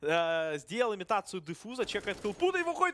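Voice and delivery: rising hype through the clutch; excited play-by-play. Real, not acted. Caster calls a clutch round.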